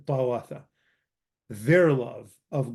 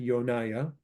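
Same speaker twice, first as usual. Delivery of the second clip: ordinary talk, nothing marked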